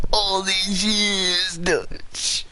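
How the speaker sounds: overacted betrayed sidekick; hammed-up heartbreak; mock wounded plea. Repeated, higher, put-on.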